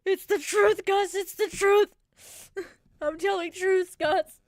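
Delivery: loud, laughing mock protest; laughing insistence. Telling Gus her rant is the truth, loud and laughing. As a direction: insist loudly through laughter.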